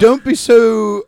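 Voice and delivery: loud, drawn-out fussy nanny voice; Mrs. Doubtfire. Acted. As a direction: scold slowly in a put-on nanny voice. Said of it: "he's going back to Miss Doubtfire".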